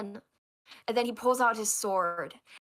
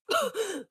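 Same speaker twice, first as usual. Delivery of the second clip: gasp; 10 semitones higher than usual. Acted. Stabbed in back.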